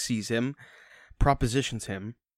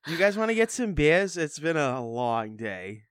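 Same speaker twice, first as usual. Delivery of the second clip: put-on Boston accent; 3 semitones higher than usual. Imitating an actor asking the guys for beers, Boston accent. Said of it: "the only accent he can do".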